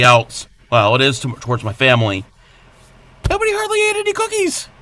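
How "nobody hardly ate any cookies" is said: very high fast whine; mock whiny high voice. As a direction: whine it like a sulky child. Mock complaint at family.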